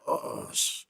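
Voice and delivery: breathy, cut-off oath under the breath; whispered curse. Retold or imitated. Meeting Agent Harvey, a whispered cut-off curse. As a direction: caught off guard, swallow the swear.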